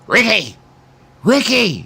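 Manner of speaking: high calling out a name across the yard; imitating a mother calling her son home; mom calling out. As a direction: yell it like a mom at the door. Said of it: "she would scream my name".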